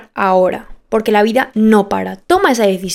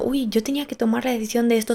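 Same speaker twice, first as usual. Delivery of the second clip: hushed, quick mock listener's worry; a hushed, hurried imagined listener; imitating listener; 9 dB quieter, faster than usual. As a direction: mutter it fast like a nervous listener. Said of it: "estás diciendo".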